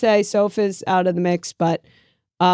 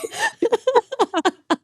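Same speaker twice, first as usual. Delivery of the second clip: high-pitched burst of laughter; laughing; 4 dB quieter than usual. Real, not acted. Podcaster cracks up at the league joke, high laughter.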